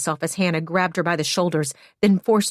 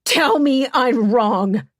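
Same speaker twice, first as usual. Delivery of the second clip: slow, high, loud demand; 7 semitones higher, 5 dB louder, much slower than usual. Acted. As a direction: spit each word out slowly. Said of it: "She demanded".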